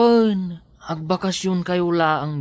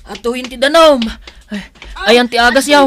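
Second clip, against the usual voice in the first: urgent call; 3 semitones higher, 7 dB louder, faster than usual. Acted. Urgent help.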